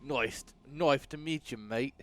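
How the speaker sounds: slow mock London accent slipping Australian; failed London accent. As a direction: attempt a Londoner, land half Australian. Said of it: "more Australian than it was Londoner".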